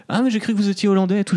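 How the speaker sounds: fast, high, cheery imitation of the taxi driver; imitating the taxi driver. Retelling the London taxi driver's surprise, high and fast. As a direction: chirp it like an amazed cabbie. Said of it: "Et le mec, il fait".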